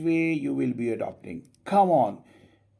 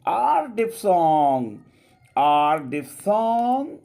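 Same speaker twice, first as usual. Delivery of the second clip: "R diphthong" drawn out and louder; slow emphatic naming; 6 semitones higher, 5 dB louder, much slower than usual. Shown as a demonstration. Teacher stressing the term to students. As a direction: slowly spell out "R diphthong" for the class.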